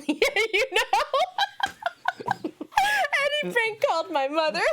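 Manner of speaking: words squeezed out through high laughter; can barely talk for laughing; laughing hard. Real, not acted.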